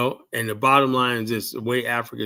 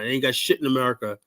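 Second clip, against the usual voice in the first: normal talking voice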